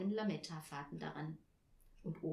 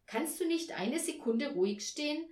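higher, exasperated question; annoyed scolding; 6 semitones higher, 4 dB louder than usual. A narrator's reading. Mother to fidgeting Barbie, annoyed scolding. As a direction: scold in mild exasperation, pitch raised. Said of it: "rief Frau Janka ärgerlich".

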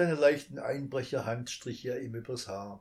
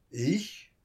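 surprised echo; 5 semitones higher, 5 dB louder than usual. A narrator's reading. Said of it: "fragte der Dieb zurück".

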